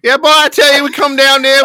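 loud, high-pitched bumbling police chief; moron police chief. Pretend. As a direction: holler it like a dim-witted police chief. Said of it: "can we get the moron police chief's impersonation?".

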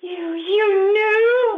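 breathless disbelief; stunned surprise. Acted. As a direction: it dawns on her they knew. Mother learns her children knew.

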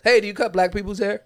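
imitated phone caller. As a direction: lightly put on a caller's questioning voice. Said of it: "I get those phone calls".

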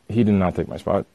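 normal talking voice

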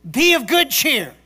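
loud proclaiming quote; preaching. Acted. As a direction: proclaim it like scripture. Quoting Jesus loudly.

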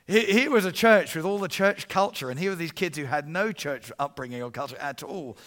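ordinary talk, nothing marked

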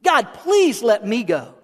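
high, slow, fervent begging; earnest, urgent appeal to God; passionate plea. Acted. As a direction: plead with zeal, stretching out the please. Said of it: "with the passion and the zeal".

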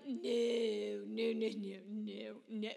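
loud, drawn-out mimicked noes; never-ending noes. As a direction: imitate the concierge saying no on and on. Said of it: "the no's never ended".

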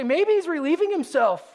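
high, amused sarcastic jab; mocking. A narrator's reading. Elijah jokes about Baal to the prophets, amused. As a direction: tease with a grin in the voice. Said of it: "Other translations will say".

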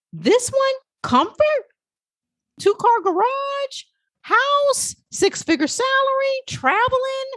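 high, eager list of comforts; excited listing. Acted. Coach listing the comforts of the safe job, high and eager.

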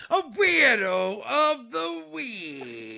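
high, slow booming announcement; dramatic announcer. Pretend. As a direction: announce it like a game show host.

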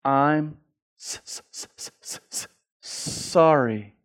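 stuck on a hissed, stuttered S before sorry; struggling to apologize. Acted. Preacher showing men who can't say sorry, stammered struggle. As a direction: choke on the word, then force it out.

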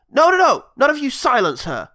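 high, loud objection; hammed-up scolding; mock outraged shout. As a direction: cut the crowd off sharply. Acted Riz scolding the crowd.

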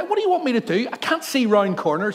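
high, loud indignant protest; exasperated shout. Retold or imitated. Pastor yelling at the man in the road, exasperated. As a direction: throw up your hands in protest. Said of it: "I said to the guy".

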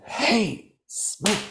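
rough wake-up. Retold or imitated. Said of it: "And sometimes with".

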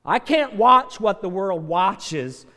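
loud, driving preacher's voice; full-force preaching. Real, not acted. Preacher urging church to save the drowning, full force. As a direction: hammer it home to the pews.

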